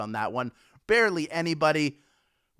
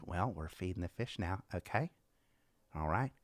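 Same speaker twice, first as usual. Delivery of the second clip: soft, low, gentle TV host; imitating Mister Rogers; 8 semitones lower, 10 dB quieter than usual. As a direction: narrate quietly, as if to a child. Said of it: "He did always say".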